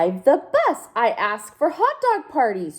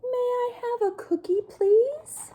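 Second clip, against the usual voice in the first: high, sweet little duckling voice; polite duckling; 13 semitones higher, 3 dB quieter than usual. Acted. Duckling politely asks for a cookie, high and sweet. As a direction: ask ever so nicely.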